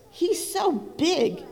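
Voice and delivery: hen voice. Acted. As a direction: pitch it up like a fussy hen. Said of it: "squawked the hen".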